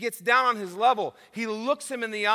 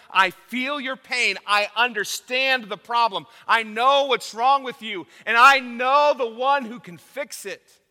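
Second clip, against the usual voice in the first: louder, building and earnest; reassuring Jesus; 4 semitones higher, 8 dB louder than usual. Retold or imitated. Preacher voicing Jesus reassuring the deaf man, building louder. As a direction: reassure him with growing force.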